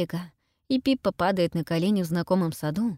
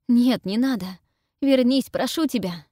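loud, desperate calling out; 5 semitones higher, 5 dB louder than usual. A narrator's reading. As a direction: call her back in despair. Gemma calls after Pippa.

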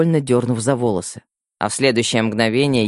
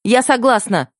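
much higher, eager shout; 11 semitones higher, 3 dB louder than usual. A narrator's reading. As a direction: blurt agreement without thinking. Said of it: "выкрикнула я".

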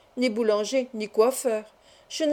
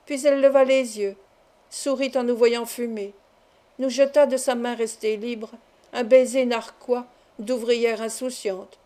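plain reading voice

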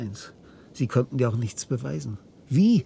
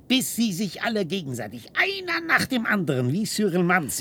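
rising frenzy; 8 semitones higher than usual. Acted.

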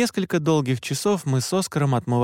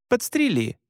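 crisp, higher-pitched command; unhesitating order; 9 semitones higher than usual. A narrator's reading. Leader answering hunter about resisters, unflinching order. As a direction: answer without a moment's doubt. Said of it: "не моргнув глазом, ответила Оливет".

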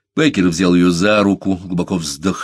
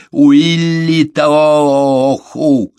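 long, high, loud chant-like declaration; forceful; 8 semitones higher, 8 dB louder, much slower than usual. A narrator's reading. Беккер beats his chest and proclaims, forceful. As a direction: call it out slowly with great force. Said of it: "сказал Беккер с силой".

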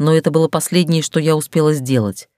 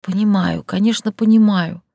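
higher, choked murmur; strangled mumble; 5 semitones higher than usual. Acted. Heroine choked, assures Dar, higher strained murmur. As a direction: force the words out choked. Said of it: "сдавленно пробормотала я".

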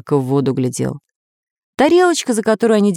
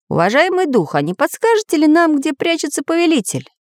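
high-pitched, deferential questioning voice; polite story character; 11 semitones higher than usual. Acted. Traveller asks spirit about the lair, high polite voice.

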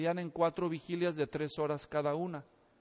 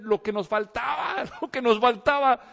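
laughing; 3 semitones higher, 6 dB louder than usual. Retold or imitated.

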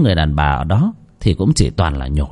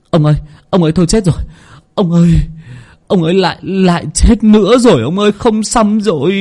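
panicked servant; 9 semitones higher than usual. Acted. Servant rushing to master with bad news, frantic. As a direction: blurt it out in alarm.

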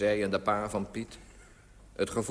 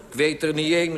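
loud, higher-pitched admission; raised voice, pitched up; calling out; 5 semitones higher, 6 dB louder, faster than usual. A narrator's reading. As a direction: shout it down to the street. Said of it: "riep ik".

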